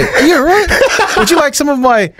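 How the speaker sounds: high, laughing offer of food; playfully sweet workmate voice; mock caring workmate. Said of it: "surely that's a sign to go".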